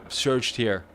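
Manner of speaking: yelling over helicopter. Real, not acted. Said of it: "excuse the helicopter".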